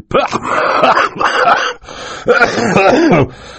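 long, loud acted coughing fit; loud hacking cough, played up. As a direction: cough and splutter hard, mid-sentence. Mister Poe's coughing fit mid-sentence.

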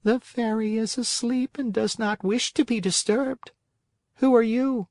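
a sleepy creature speaking down; snail voice. Acted. Snail answering from window.

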